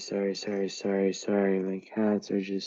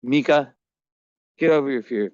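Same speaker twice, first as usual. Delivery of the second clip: louder firm call; calling cat; 3 semitones higher, 5 dB louder than usual. Real, not acted. Owner coaxing frightened Mika, louder firm call. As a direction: urge the scared cat on.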